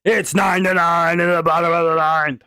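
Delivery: mock play-by-play announcer. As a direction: call it like a stadium announcer. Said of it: "how's my announcer voice?".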